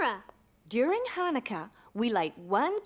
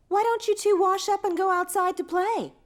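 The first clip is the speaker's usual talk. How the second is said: calling out; 6 semitones higher, 5 dB louder than usual. Acted. Mom sending kids outside.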